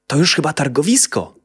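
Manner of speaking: pleased. A narrator's reading. Boy pleased to reach the market. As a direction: sound glad to arrive. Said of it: "powiedział uradowany niedźwiadek".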